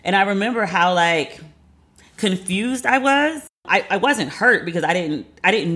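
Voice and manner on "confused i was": high, slow, drawn-out "confused". Real, not acted. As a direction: stretch it out in disbelief. Stretched word for emphasis.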